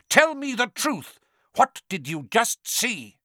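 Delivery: high, loud interrogation; shouted demand. A narrator's reading. Quirrell demanding truth from Harry, shouting. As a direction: demand it, starting high and easing a little. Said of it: "Quirrell shouted".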